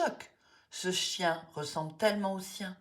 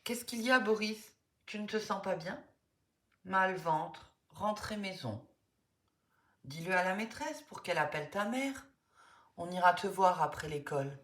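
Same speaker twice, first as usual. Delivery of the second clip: normal talking voice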